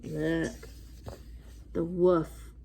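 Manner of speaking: ordinary delivery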